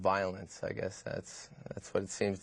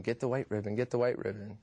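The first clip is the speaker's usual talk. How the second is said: usual speaking voice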